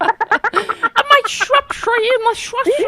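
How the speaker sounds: put-on shrieky high, loud parody English accent; mock English accent. Host teasing co-host about Shropshire, shrill mock English accent. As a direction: mimic a panicked fake English accent at top pitch. Mimics co-host's panicky accent.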